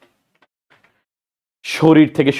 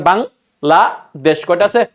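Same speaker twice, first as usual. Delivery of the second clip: Bangladesh counted out slowly beat by beat; slow counting; much slower than usual. Shown as a demonstration. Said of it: "Bangladesh উচ্চারণ করেন".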